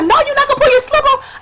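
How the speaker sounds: high, rushed imitation of a scandalised neighbour; mock shocked protest. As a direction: squeal the objection in someone else's voice.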